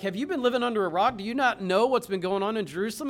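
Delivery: fast, loud, disbelieving retort; mock exasperated have-you-been-under-a-rock; incredulous disciples. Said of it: "And they're basically like".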